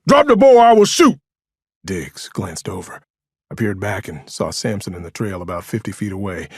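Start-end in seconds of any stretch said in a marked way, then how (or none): 0.1-1.2 police officer's shouted warning, high and loud; yelling. A narrator's reading. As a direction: bellow an armed order. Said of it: "I heard John yell behind me".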